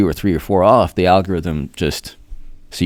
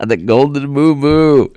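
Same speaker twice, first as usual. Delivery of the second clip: calling out; 6 semitones higher than usual. Acted. Calls out a viewer's name.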